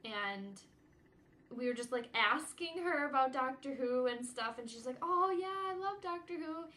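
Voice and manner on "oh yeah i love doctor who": high, sing-song mock British accent; imitated British accent. Neighbor's friend from Britain gushing about Doctor Who, imitated British accent. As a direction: imitate a British visitor, pitched well up. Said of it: "she was talking in her British accent".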